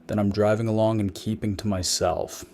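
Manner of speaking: plain reading voice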